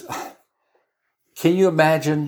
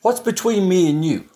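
mild challenge; 5 semitones higher than usual. Acted. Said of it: "one translation says".

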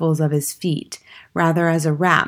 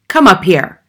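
loud, commanding bellow; Trunchbull's shout; 3 semitones higher, 10 dB louder than usual. A narrator's reading. Headmistress orders a boy onto the platform, shouting. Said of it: "the Trunchbull shouted".

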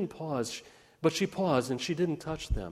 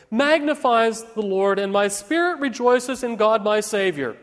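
child's full-voiced scripture recital; pageant declamation; 8 semitones higher, 9 dB louder than usual. A narrator's reading. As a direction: proclaim it to the whole congregation. Mary declaims lines in pageant.

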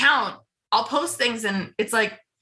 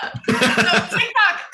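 laughing, drawn out; 11 semitones higher, 4 dB louder, slower than usual. Real, not acted. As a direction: laugh through the words. Laughs after being told go.

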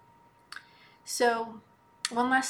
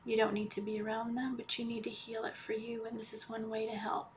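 guided meditation; 8 dB quieter than usual. Acted. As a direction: ease them into calm, almost whispering. Leads a healing meditation.

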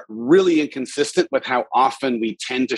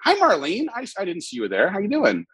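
mock surprised greeting; 7 semitones higher, 3 dB quieter than usual.

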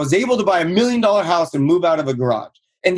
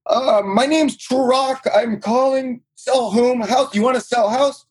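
higher, slow halting broken English of a fumbling rookie; clumsy nervous caller; 7 semitones higher, slower than usual. Retold or imitated. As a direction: stumble through it like a scared beginner. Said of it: "I sounded like an idiot".

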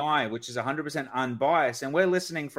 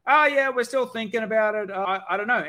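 noncommittal customer; 7 semitones higher than usual. Retold or imitated. Customer answering sales call.

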